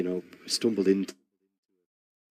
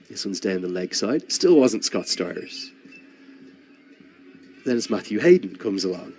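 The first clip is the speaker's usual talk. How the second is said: ordinary talk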